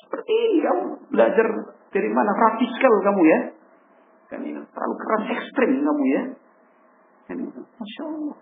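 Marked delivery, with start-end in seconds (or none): 0.0-6.2 high-pitched, indignant father accusing; an offended parent snapping at the child, raised pitch. Acted. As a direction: toss the charge back, pitch raised. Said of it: "Orang, orang tua ya kan".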